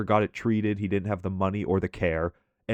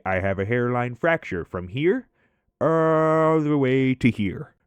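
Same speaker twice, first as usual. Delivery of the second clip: higher and slow, dragged out on "all the way to here"; imitating a coworker overselling his injury; Mike's dramatic fracture claim; 4 semitones higher, slower than usual. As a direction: stretch it out like a grave warning.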